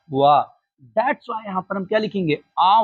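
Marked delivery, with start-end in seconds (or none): 0.1-0.4 वाह said lower, a clear "wa" for the start of one; sound wa. Shown as a demonstration. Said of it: "क्या बोला जा रहा है".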